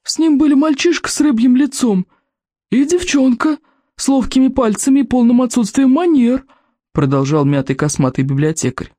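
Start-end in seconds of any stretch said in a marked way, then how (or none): 0.0-6.4 put-on cranky old librarian. A narrator's reading. Голос библиотекаря. (сварливый старик-библиотекарь, высоко и протяжно)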